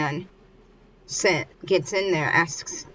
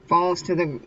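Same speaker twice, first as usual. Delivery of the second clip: ordinary delivery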